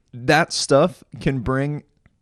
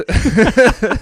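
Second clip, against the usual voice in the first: big cracking laugh, much higher; real high loud laugh; loud high burst of laughter; 11 semitones higher, 9 dB louder than usual. Real, not acted.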